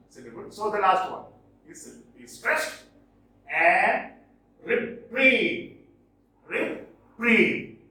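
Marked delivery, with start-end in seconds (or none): 4.6-5.8 "reprieve" with a high, falling final syllable; light "re", strong "PRIEVE" in "reprieve"; teacher's end-stressed "reprieve"; stress on second syllable. Shown as a demonstration. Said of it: "Second syllable stressed". 6.5-7.8 "Reprieve" split, with "PRIEVE" high and falling; emphatic re-PRIEVE for "Reprieve"; stress on second syllable. Shown as a demonstration. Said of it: "Second syllable stressed".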